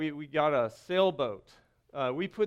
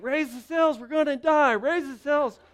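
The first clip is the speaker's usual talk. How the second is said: higher, louder frantic yell; panicked shout; 7 semitones higher, 3 dB louder than usual. Retold or imitated. Husband yelling to raise the sails in the storm. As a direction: yell it like you're sinking.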